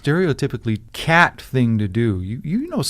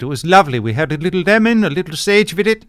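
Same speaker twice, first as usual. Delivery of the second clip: put-on loud, higher, smug German accent; savouring the lunch in a German accent; German scientist accent; 5 semitones higher, 8 dB louder than usual. German scientist taunting Rose about lunch, German accent. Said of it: "the German scientists refuse to let her in".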